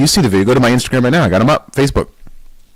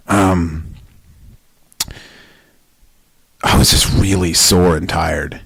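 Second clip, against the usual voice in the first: sheepish voice; 4 semitones lower, slower than usual. Retold or imitated. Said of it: "I look over at Donnie and I go".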